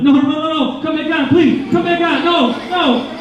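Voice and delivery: high, whiny begging; mock pleading. Getting dressed when the heater cuts out, high mock begging. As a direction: beg the heater like a child.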